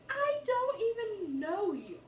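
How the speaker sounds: high, loud, slow exasperated yell; exasperated shout. Retold or imitated. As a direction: shout it out, fed up. Refusing a creepy caller.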